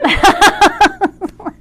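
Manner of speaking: loud, high peal of laughter; real cracking-up laugh; helpless laughter. Real, not acted. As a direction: laugh hard at your own tease. Teasing friend over misunderstanding.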